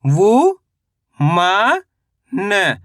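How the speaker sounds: वु, मा, न as separate pieces, long and rising on the first two; sound by sound. Shown as a demonstration. Teacher breaking a word down for learners, syllable by syllable. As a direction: spell out वु ... मा ... न with pauses. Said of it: "तो मिलके बन जाएगा".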